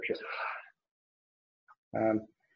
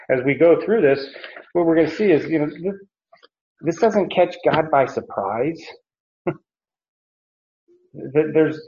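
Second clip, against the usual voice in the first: normal talking voice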